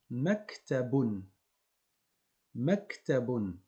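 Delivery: "Maktabun" read very slowly, each syllable apart. Shown as a demonstration. Teacher reads "Maktabun" very slowly for learners.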